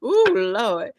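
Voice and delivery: crying out through laughter; laughing cry. Real, not acted. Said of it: "Not the Rudy and the Tootie spirit".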